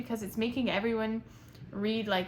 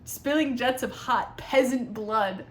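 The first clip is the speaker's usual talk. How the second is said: mock dramatic reading; 2 semitones higher, 5 dB louder, slower than usual. Shown as a demonstration.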